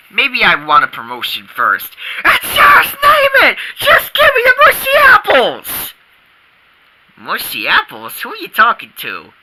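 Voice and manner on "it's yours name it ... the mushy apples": very high, loud urgent demand; frantic eagerness. Acted. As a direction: grab for the apples in a rush. Impatient urgency for apples.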